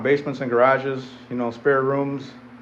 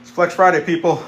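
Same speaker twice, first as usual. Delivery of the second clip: louder, higher, pumped-up call; rally the viewers with energy; hyped shout-out; 4 semitones higher, 6 dB louder than usual. Real, not acted.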